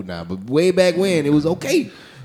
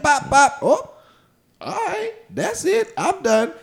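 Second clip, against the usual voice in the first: high sheepish; 10 semitones higher, slower than usual. Retold or imitated. Quick finish.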